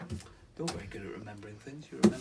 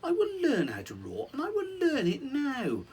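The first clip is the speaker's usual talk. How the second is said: high, rising, louder mouse voice with a laugh; determined mouse; 13 semitones higher, 5 dB louder than usual. Acted. The mouse vowing to learn to roar, eager and squeaky. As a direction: build a squeaky mouse's resolve.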